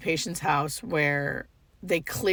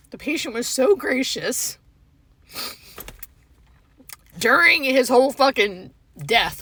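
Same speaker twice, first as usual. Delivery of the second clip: anguished; 5 semitones higher, 5 dB louder than usual. Real, not acted. Grief while recounting the death.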